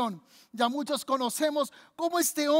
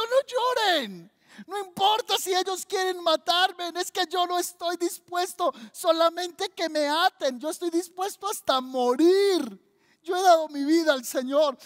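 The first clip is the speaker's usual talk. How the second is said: higher, fervent declaration, stretched at the start; impassioned protest; 5 semitones higher than usual. Acted. Paul telling the weeping disciples he will die, impassioned and raised. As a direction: cry out with fearless resolve.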